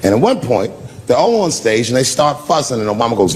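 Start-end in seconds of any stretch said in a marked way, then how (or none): none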